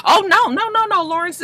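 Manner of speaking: high-pitched, over-polite brush-off; mock placating. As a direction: imitate overly nice bosses waving off concern. Superiors placating Lawrence, acted.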